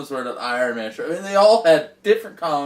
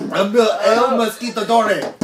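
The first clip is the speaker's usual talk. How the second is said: mock Spanish accent; 7 semitones higher, 6 dB louder than usual.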